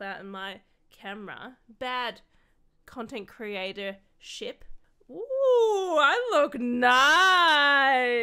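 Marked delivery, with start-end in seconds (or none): 5.1-8.1 high, very loud, stretched out; delighted whoop. Real, not acted. Creator checking herself on camera, loud delighted whoop. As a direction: sing out with self-admiration. Admires self wearing headset on camera.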